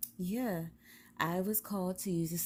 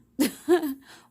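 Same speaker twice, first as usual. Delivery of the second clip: loud laugh; 11 semitones higher, 13 dB louder than usual. Real, not acted. After teasing the viewers.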